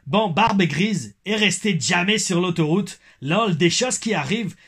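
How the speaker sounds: put-on slow, high Québécois accent slipping into Belgian; botched Québécois accent. Reading a fan's comment in a wobbly Belgian-Québécois accent. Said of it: "pourquoi j'ai l'accent belge".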